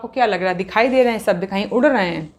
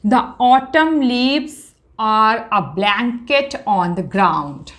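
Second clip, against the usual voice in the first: drawn out, first words raised; slow reading aloud; 2 semitones higher, much slower than usual. Shown as a demonstration. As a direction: present the line slowly. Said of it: "अब देखिए".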